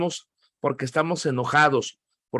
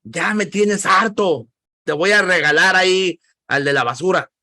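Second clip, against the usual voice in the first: fed-up parent; 3 semitones higher, 4 dB louder than usual. Acted. Dad to misbehaving kids, acted exasperated threat. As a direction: scold a child, dragging out the words. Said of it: "ya cuando se están portando mal".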